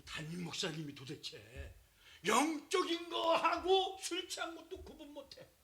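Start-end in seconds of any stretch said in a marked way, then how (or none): 2.2-5.5 high, loud exasperated cry; indignant outburst. Real, not acted. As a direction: throw up your hands in disbelief. Preacher indignant at Eli.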